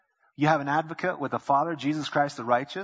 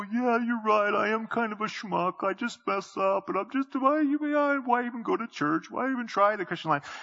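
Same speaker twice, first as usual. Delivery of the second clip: whiny, higher self-pitying voice; 8 semitones higher than usual. Retold or imitated. Preacher voicing a guilty believer, higher and whining. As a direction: play the guilty believer agreeing with the devil. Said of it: "I go".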